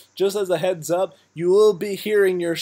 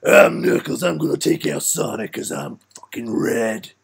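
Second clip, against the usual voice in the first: gruff tough-guy character voice; Knuckles voice; 5 semitones lower than usual. Acted. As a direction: growl it out as a cocky brawler. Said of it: "so I auditioned for Knuckles".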